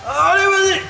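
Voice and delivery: high yell of effort; straining shout. Real, not acted. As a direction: cry out while hauling the weight. Urging during heavy lift. (cri aigu sous la charge)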